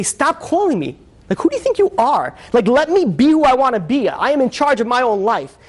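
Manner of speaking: high-pitched, exasperated protest; indignant son. Retold or imitated. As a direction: act out an angry son telling off his mother. Son snaps at mother.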